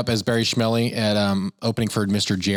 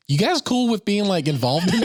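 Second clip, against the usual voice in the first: casual ask; 10 semitones higher than usual. Retold or imitated. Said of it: "it's like".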